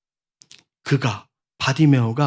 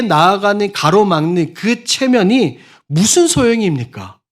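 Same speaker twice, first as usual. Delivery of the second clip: louder, higher urgent preaching; fiery preaching; 6 semitones higher, 6 dB louder than usual. Acted. Preacher urging the congregation, fiery loud preaching. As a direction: drive the challenge home to the congregation.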